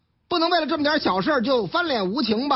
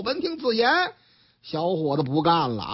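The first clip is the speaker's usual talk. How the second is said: ordinary delivery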